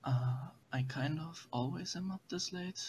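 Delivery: hushed whisper so others sleep; library whisper. Real, not acted. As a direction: whisper softly, people sleeping nearby. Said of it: "Are you whispering because there are people sleeping near you?".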